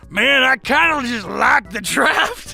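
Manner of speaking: drawn-out goofy soldier; dopey soldier voice. Retold or imitated. World War Two guy praising the draft, slow goofy voice.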